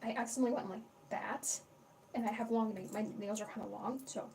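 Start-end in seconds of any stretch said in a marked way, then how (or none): none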